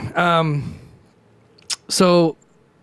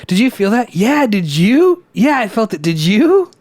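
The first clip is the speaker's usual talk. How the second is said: higher, louder chirpy back-and-forth; mock excited chatter; 7 semitones higher, 5 dB louder than usual. Reenacting the landlord chat, high chirpy mock exchange. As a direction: ham up two people parroting each other. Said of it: "it was exactly like that".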